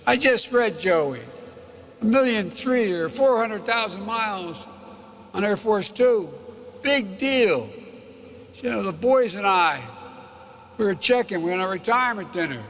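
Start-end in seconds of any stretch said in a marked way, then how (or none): 0.1-7.7 higher, louder put-on voice of a gruff old train conductor; hammed-up conductor teasing his famous passenger; conductor's voice. Conductor teasing the vice president about flying miles, in a higher, louder put-on voice. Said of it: "And he said".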